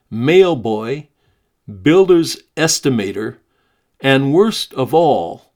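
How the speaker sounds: lively, expressive reading of a memoir. Acted. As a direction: read the story aloud with energy and emphasis. Host reads Keith's memoir aloud.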